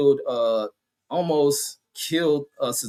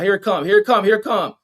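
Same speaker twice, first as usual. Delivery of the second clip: excited; 5 semitones higher, 5 dB louder, faster than usual. Real, not acted. Waiting for the replay body shot.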